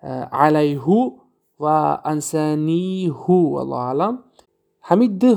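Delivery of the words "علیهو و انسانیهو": علیهو and انسانیهو recited slowly with the ending as "hu"; slow Quranic reading with a "hu" ending; Quranic exception. Shown as a demonstration. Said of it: "در قرآن دو مرتبه".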